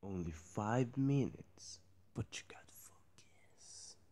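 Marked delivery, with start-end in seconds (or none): 2.1-4.0 soft whisper. Retold or imitated. Whispered line.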